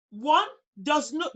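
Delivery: ordinary talk, nothing marked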